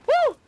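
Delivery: very high, loud whoop; sudden yelp of alarm; startled whoop. Real, not acted. Player startled mid-attack, high whoop.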